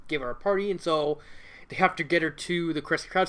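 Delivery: ordinary delivery, nothing marked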